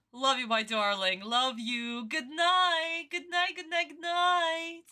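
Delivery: between kisses. Real, not acted. Between kisses, saying good night.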